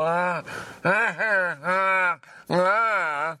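high, strained open-mouthed "ah"s; acted struggle for air; mimed choking gasps. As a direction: gag out short, high gasps as if your mouth is stuffed. Mimes mouth-breather choking on things in mouth.

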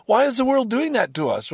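a lightly voiced angry Russian man asking why; aggrieved Russian citizen. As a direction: complain like a citizen hurt by sanctions.

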